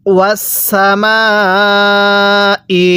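very slow ওয়াসামা with the vowel stretched, then ই; long drawn vowel. Shown as a demonstration. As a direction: hold the long vowel out fully before ই. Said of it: "আমার সাথে টান দিবেন". (টান দিয়ে লম্বা করে ওয়াসামা ই)

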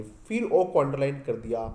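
ordinary talk

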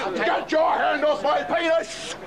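outraged high protest; indignant yell at the cop; crying with rage. Real, not acted. Outraged man being arrested.

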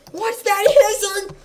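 high, loud and slow panic; terrified wail; shrill frightened cry; freaked out. Real, not acted. Creeper hissing fright.

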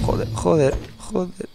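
muttered curses fading; cursing under breath. Real, not acted. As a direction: swear softly to yourself.

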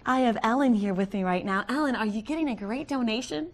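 bright telethon host. Pretend. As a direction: welcome viewers back to telefund. Hosts the telefund.

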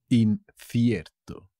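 "Incierto" slow, "In" set apart; teacher's slow, split-up "Incierto"; slow demonstration. Shown as a demonstration. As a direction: break "Incierto" after the first syllable, slowly. New word shown slowly to learners.

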